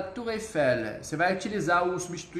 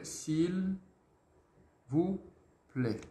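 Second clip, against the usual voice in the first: "S'il vous plaît" broken word by word, softer; 6 dB quieter, much slower than usual. Shown as a demonstration. Teacher splits the phrase word by word for students. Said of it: "Comme ça".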